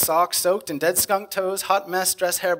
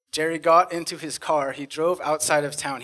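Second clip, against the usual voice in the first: ordinary delivery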